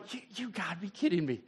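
quiet disbelieving grumble; muttered disbelief. Retold or imitated. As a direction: grumble it to yourself. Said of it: "He's just sitting here going".

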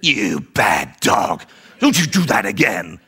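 low, very slow growling scold. Retold or imitated. Owner grabbing the returned dog, low slow scolding. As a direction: drag the words out in a menacing telling-off. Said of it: "do ugly face".

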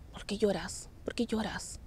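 retold whisper, very soft; susurro. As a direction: whisper gently to someone crying. Asking a crying apparition at night. (pregunta susurrada, muy bajito)

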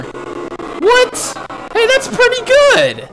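very high, loud surprised squeal; shocked squeal. Real, not acted. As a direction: squeak out in disbelief. Surprising score screen.

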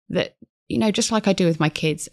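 normal talking voice

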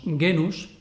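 genus with a hard G as in gato. Shown as a demonstration. Teacher showing classical Latin reading, hard G in genus. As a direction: say genus with a velar stop G. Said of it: "La G se lee siempre velar oclusiva".

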